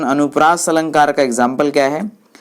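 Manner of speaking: ordinary delivery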